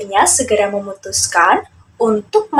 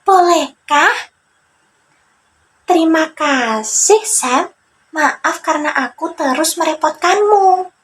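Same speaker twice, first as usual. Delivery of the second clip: higher, sheepish character voice; timid young friend, higher pitched; Joni grateful; 7 semitones higher than usual. Acted. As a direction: thank him a little guiltily. Joni thanks Sam.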